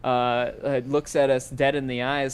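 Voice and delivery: ordinary talk, nothing marked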